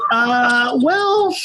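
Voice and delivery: high-pitched, strained hedging; hesitant reply. Retold or imitated. Stranded driver answering whether the location is safe, high-pitched hesitation. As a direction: stall with a squeaky, drawn-out uncertain answer. Retold awkward reply to roadside operator.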